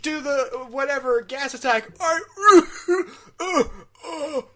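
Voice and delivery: strained high, wheezy put-on voice; Optimus voice. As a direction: push a strained comic voice through. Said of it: "Talking like Optimus".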